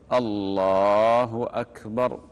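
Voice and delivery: slow, full "الله أكبر"; demonstrated takbir. Shown as a demonstration. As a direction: call "الله أكبر" as if opening prayer. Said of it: "يعني تقول".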